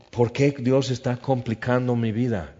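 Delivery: normal talking voice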